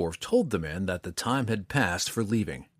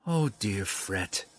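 comforting; slower than usual. A narrator's reading. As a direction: soothe the upset dwarf gently. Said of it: "Dove offered, dropping a comforting hand".

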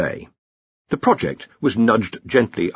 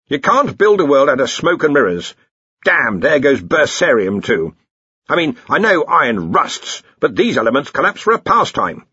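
higher, exasperated grumble; Ridcully; 5 semitones higher, 4 dB louder than usual. Acted. Arch-Chancellor laments collapsing elements, exasperated.

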